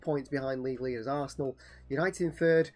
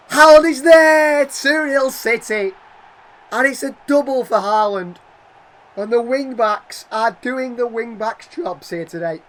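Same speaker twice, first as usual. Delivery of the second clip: shouted much higher and louder, slow; excited goal shout; 7 semitones higher, 14 dB louder, slower than usual. Real, not acted. Gamer calling Haaland's header goal, shouting with joy. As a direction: scream the second goal like a commentator.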